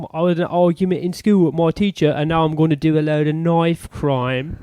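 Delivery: put-on English geezer voice; hammed-up English lad with hesitant starts; English accent. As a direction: do a mock English "guvnor" accent. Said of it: "wanna hear my English one?".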